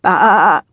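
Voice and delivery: one loud, quavering bleat; sheep bleat. A narrator's reading. Hidden sheep alerts the villain's crew, bleating loudly. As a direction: let out a big sheep's baa. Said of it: "The noise alerted Pinchley".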